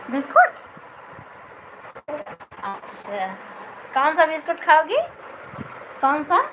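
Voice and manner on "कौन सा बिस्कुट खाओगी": ordinary delivery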